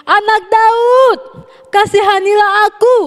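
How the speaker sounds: high, slow, drawn-out pleading cry. A narrator's reading. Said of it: "semakin keras ia berseru".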